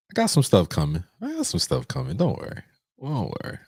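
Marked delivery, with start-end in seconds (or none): 1.2-3.6 tiny falsetto tease; small, high, teasing squeak; playful high soft voice. Pretend. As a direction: go way up high and quiet.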